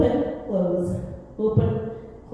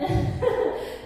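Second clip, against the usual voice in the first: breathy laugh; 11 semitones higher than usual. Real, not acted. About to scratch face, laughs.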